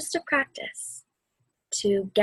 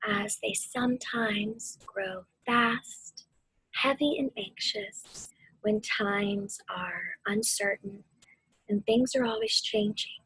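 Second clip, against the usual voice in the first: guided meditation. Acted. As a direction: ease them through the storm and back to calm.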